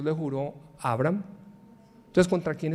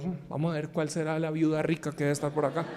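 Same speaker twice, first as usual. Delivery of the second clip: much softer, scheming little aside; Elías arrives looking for the rich widow, under his breath; mock hopeful musing; 3 semitones lower, 8 dB quieter than usual. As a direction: muse to yourself, half-joking, eyeing a rich widow.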